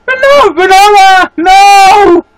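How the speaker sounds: high, loud cry after a lost friend; anguished shout. Pretend. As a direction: yell her name as she drifts away. Calling out to lost character.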